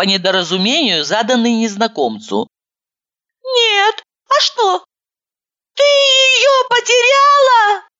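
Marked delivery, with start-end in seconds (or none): 3.4-7.9 high, teary, slow phone voice; a weepy woman picking up the phone; tearful high woman. A narrator's reading.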